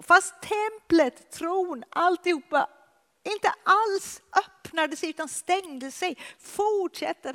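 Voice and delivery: high, loud impassioned preaching; a preacher building to a climax, high and loud; full-force preaching. Acted. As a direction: lift it and drive it home with fervour.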